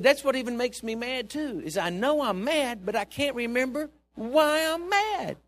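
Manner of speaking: loud, high, drawn-out frustrated outburst; retold exasperation. Retelling old woman's exasperated outburst.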